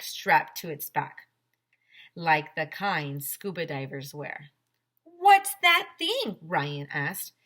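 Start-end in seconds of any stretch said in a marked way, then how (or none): 5.0-6.4 much higher and louder, slow; amazed question. A narrator's reading. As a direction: gasp out the question in wonder. Ryan amazed by flamethrower.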